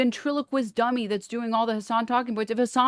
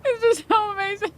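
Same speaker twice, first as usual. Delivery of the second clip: laughing; 12 semitones higher than usual. Real, not acted. Laughing at sponsor joke.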